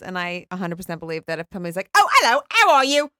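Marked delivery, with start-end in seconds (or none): 2.0-3.1 loud, high put-on greeting; transformed character voice. Actor showing off a transformation, loud put-on voice. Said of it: "they can transform themselves".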